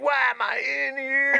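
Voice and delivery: high, put-on wail; mimicked erratic drunk. Maced cellmate asking the narrator, mock bewildered wail. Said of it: "he was on some type of drug".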